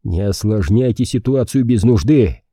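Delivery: plain reading voice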